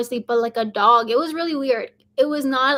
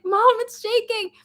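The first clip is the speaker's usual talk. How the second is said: high-pitched mock yell to mom; retold yell; 10 semitones higher than usual. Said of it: "I yelled at my mom".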